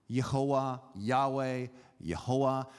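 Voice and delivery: Yehoah beside Yahweh, both with Y and W; non-English pronunciation. Shown as a demonstration. Preacher comparing the names, Yehoah and Yahweh with Y sounds. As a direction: alternate Yehoah and Yahweh evenly, Y in place of J. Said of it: "in most languages, you would pronounce this".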